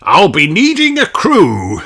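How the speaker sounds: high, loud pirate voice; pirate captain's voice. Acted. Redbeard asks for a crew. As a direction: speak as a hearty pirate captain.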